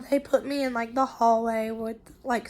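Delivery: normal talking voice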